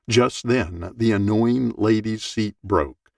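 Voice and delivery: usual speaking voice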